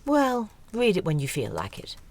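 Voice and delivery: ordinary delivery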